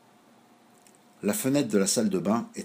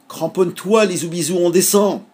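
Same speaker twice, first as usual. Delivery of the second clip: higher, louder cry as the gull swoops down; excited call; 8 semitones higher, 6 dB louder than usual. A narrator's reading. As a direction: call out over the wind, thrilled. Gull dives, calls to child. (cri excité de la mouette, plus aigu)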